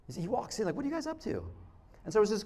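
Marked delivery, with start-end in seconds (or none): 0.7-1.4 higher, softer offhand question; casual greeting. Acted. Jesus walks in on the disciples, casual. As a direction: stroll in with a light, soft hello. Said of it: "Very almost casual".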